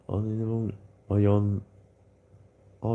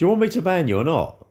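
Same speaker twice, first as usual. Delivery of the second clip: higher and louder, fed up; exasperated; 8 semitones higher, 4 dB louder than usual. Real, not acted. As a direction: demand an answer at last, loudly. Fed up with indecisive chatter.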